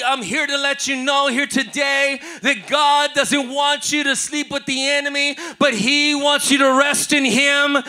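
loud, driving preacher call; full-force preaching. Acted. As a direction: preach hard and rouse the room.

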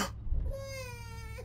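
sharp high gasp of fright; a sudden shocked intake of breath; startled gasp. Real, not acted. Baby suddenly appears.

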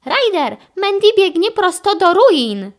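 high, loud urgent call; urgent report. A narrator's reading. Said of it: "zameldowała".

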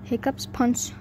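usual speaking voice

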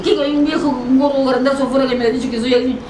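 high, plaintive tattling without real sobs; retold tearful complaint. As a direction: mimic complaining through tears, pitched high. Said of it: "Me fui adentro llorando y le digo". (queja llorosa imitada, en tono agudo)

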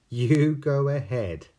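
laughing gruffalo. Acted.

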